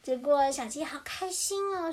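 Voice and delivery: plain reading voice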